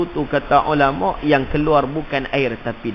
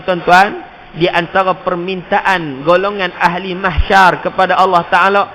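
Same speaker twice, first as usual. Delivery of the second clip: normal talking voice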